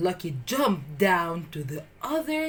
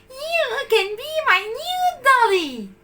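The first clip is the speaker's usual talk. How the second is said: put-on high, sweet girl's voice; girl adopting puppy as her doll; little girl; 5 dB louder than usual. A narrator's reading. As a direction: claim the puppy happily. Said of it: "she said".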